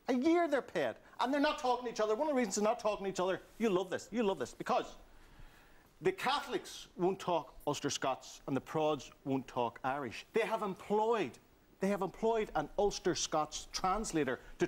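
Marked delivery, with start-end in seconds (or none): none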